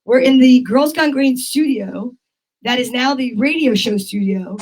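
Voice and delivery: normal talking voice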